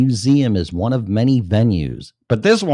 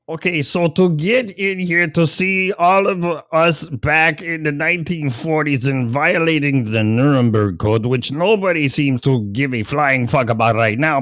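higher mock-official voice with a sneer; sarcastic museum voice; 6 semitones higher than usual. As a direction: play the smug institution, sneering. Mocking the museum's voice.

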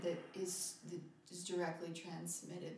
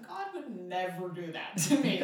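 insistent mock protest; 12 dB louder than usual. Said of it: "I'm like".

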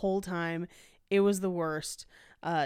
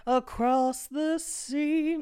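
slow, higher dramatic line; mock dramatic; 6 semitones higher, much slower than usual. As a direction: stretch it out theatrically. Continues the bit.

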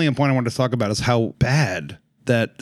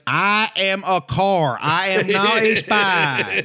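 slow, higher, mock-emphatic declaration; deliberate spelling-out; 4 semitones higher, slower than usual. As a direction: spell it out slowly, word by word. Spelling out a simple point, quoted.

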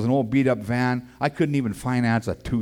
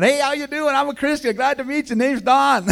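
high, hammed-up cheery hello; much higher, beaming mock welcome; overeager greeting; 5 dB louder than usual. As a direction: play it as a goofy, too-friendly newcomer. Acting himself greeting church folk.

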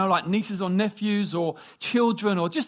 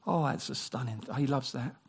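lower, hushed awe; soft marvel; 7 semitones lower, 8 dB quieter than usual. Retold or imitated. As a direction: marvel quietly. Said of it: "He goes".

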